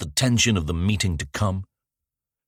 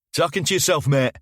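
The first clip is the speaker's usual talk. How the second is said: put-on jeering teen; 9 semitones higher than usual.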